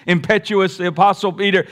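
ordinary talk, nothing marked

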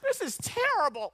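high, loud hammed-up wail; comic dismay. Preacher complaining about Tampa to congregation, melodramatically appalled. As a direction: wail it in mock horror.

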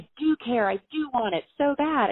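high, loud pleading insistence; mad insistence. Retold or imitated. Insisting to her brother that she cares, high and mad. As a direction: fight to be believed. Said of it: "really mad".

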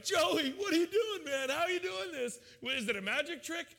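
high, loud astonished cry; amazed shout. Retold or imitated. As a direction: react like your friend just walked on water. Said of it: "would you be like".

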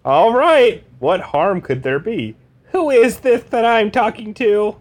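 high comic Carr voice. Acted. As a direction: agree and then demand who it is. Carr voice on the phone.

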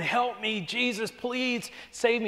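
high pleading voice, slowing at the end; imitating a mother's anguished plea; desperate plea. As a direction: beg Jesus to save her. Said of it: "she's asked".

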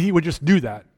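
usual speaking voice

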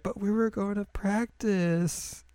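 high, slow whiny complaint; whiny protest. Retold or imitated. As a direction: whine it like a put-upon girl. Mio's whiny protest in recap.

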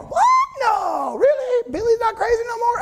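very high, fast astonished squeal; can't believe the news; a townsperson gasps at the news, high and fast; incredulous townsperson. Acted.

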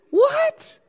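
high, rising amused disbelief; laughing what. Acted. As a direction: laugh then squeak it out. Laughing at Jesus eating fish.

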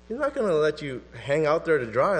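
ordinary delivery

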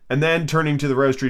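plain reading voice

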